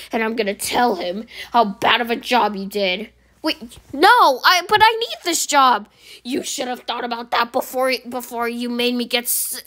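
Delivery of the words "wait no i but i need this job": higher, louder desperate plea; pleading. Acted. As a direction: beg not to be reported. Employee pleads to keep job.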